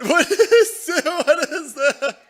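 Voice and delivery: high, loud, laughing alarm; real laugh bursting through the questions; laughing disbelief. Real, not acted. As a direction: crack up in startled disbelief. Startled by noise of cat.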